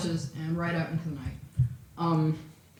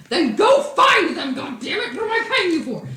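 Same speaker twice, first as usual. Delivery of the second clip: angry boss; 9 semitones higher, 8 dB louder than usual. Acted. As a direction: roar at your useless staff. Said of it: "kinda legitimately angry now".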